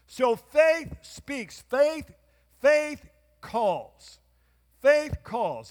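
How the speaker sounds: high, loud, slow declaration; full-force preaching. Acted. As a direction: proclaim it over the crowd. Preaching peak.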